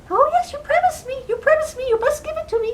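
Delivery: very high-pitched squeaky little man; insistent little man. Acted. Little man claims the baby from the queen, insistent. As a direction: pester in a tiny high voice.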